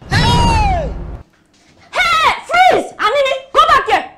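very high, loud yells of hey and freeze; furious shout. Acted. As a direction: scream them to a stop. Mother catching kids, shouting.

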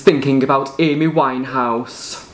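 slow, drawn-out announcer voice; Big Brother narrator. Acted. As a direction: announce each housemate slowly like the TV show.

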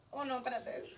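ordinary talk, nothing marked

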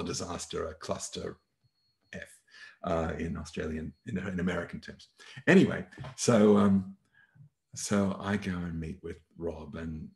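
ordinary talk, nothing marked

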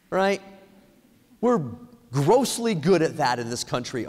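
ordinary talk, nothing marked

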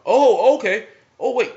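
high, loud sudden "oh, wait"; excited realization. Retold or imitated. Kid telling mom, suddenly excited about the movie. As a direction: catch yourself mid-reply, thrilled.

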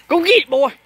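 high, loud excited call; excited dog call. Real, not acted. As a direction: cheer the dog on. Sending dog after ball.

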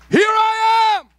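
very high, loud cry; high, loud plea; climactic cry. Acted. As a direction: cry it out to heaven.

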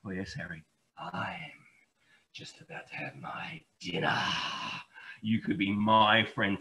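low, quiet, sly fox. Acted. Fox luring the hedgehog, low and sly. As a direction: purr it like a crafty predator. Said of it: "replied with a sly smile".

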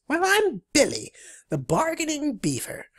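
high, squeaky beaver voice; Billy. Acted. Bargaining beaver introducing its services, high squeaky voice. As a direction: chirp it in a high cartoon voice. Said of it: "exclaims Billy".

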